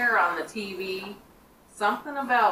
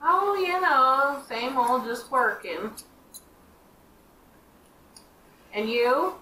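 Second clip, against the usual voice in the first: high, slow, casual in-character voice; in-character reply; 6 semitones higher, 3 dB louder than usual. Acted. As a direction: answer breezily as your character. Player answers in character.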